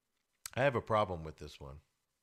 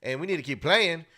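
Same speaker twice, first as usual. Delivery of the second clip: model coach's rallying talk; 6 semitones higher, 5 dB louder than usual. Retold or imitated. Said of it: "That's what you need to say".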